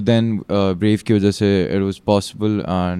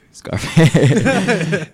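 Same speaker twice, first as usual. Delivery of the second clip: higher, laughing nickname; 8 semitones higher than usual. Real, not acted. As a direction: chuckle the name out.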